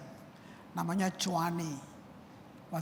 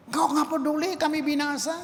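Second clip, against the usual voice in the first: high, louder frightened accusation; panicked reproach; 7 semitones higher, 3 dB louder than usual. Retold or imitated. Disciples waking Jesus in the storm, panicked. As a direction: demand an answer in fear. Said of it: "Kamu lihat, ini dia".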